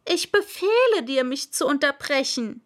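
high, loud royal order; pompous king ordering hastily; hasty king. Acted. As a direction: rush to command it. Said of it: "sagte der König eilig".

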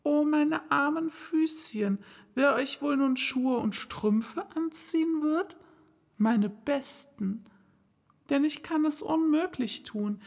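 Alice fussing over her poor feet; fretful little girl. Acted. Alice talking to her far-off feet, worried and fussing.

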